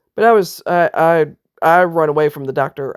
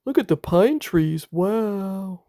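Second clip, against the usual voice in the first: mocking oblivious bystander; 3 semitones higher, 4 dB quieter, slower than usual. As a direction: play the clueless onlooker staring at scenery. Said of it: "just looking at you like".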